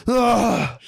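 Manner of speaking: sudden high startled cry; startled yelp. Retold or imitated. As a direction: jolt awake with a yelp. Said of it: "You just wake up like".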